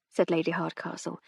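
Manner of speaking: usual speaking voice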